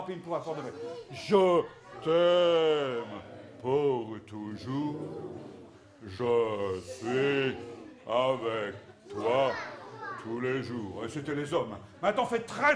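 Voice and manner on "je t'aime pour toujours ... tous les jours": deep low rehearsal singalong; a trial run in a lowered voice; mock rehearsal take, men. Shown as a demonstration. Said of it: "une fois, euh, pour, euh, du semblant".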